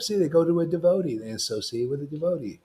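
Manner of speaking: ordinary talk, nothing marked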